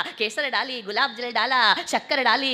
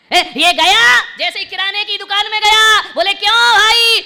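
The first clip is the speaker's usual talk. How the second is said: full-force storytelling; 10 semitones higher, 12 dB louder than usual. Acted. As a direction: belt the story out to a big crowd. Preacher belting out an anecdote.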